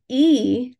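"E" drawn out slowly as a long ee; long vowel. Shown as a demonstration. Teaching the lengthening sound, a long, slow "E". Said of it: "that long I sound".

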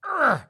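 loud, high grunt of disgust; exasperated ugh. Retold or imitated. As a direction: let out a sharp, exasperated "ugh". Exasperated father groans before speaking.

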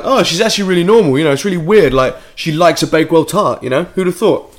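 high, loud, mock-amazed gushing; gushing celebrity. Celebrities talking about the Queen, mock gushing. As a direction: gush over trivia as an impressed celebrity, mockingly. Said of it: "these celebrities who were like".

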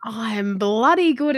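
usual speaking voice

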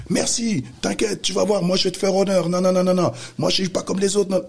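high, excited gush of thanks; overjoyed kid. Retold or imitated. Young rapper thanking him on the phone, overjoyed and high. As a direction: squeal it like a thrilled teen.

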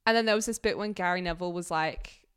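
ordinary delivery

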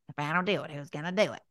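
whiny, put-upon grumble; resentful. Shown as a demonstration. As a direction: complain like a resentful martyr. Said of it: "We get really resentful".

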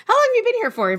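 high-pitched imitation of curious people; nosy questioner. Imitating people who ask how long she's been here, curious. Mimics people asking about her accent.

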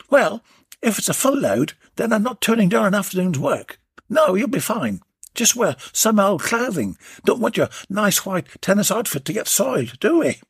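high cheeky tradesman, teasing; cheeky workman flirting on the phone; Warren's voice. Acted. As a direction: tease her with a grin in the voice.

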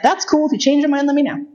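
high, airy, light and breezy; breezy casual reply. Retold or imitated. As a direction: toss it off high and easy, no pressure.

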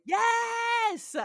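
high, stretched-out real cheer; excited yell. Real, not acted. Host remembers the space psychologist with co-host, excited yell. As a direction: burst out in delighted agreement. Delighted agreement with co-host's callback.

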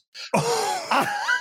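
helpless squeaky laugh; high wheezing laugh. Real, not acted. Father laughing at his daughters' cat mishap, high wheeze. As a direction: laugh high and helpless at your own story.

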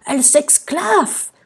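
high, drawn-out outraged roar; roaring king. A narrator's reading. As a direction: roar it slowly like a scandalised king. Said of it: "rugit le roi". (rugissement indigné)

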